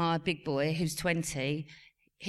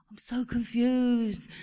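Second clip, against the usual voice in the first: slow, higher mock complaint; imitated confused teen; 5 semitones higher, much slower than usual. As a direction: drag out the teenage son's bafflement. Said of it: "all you can hear is Harry saying".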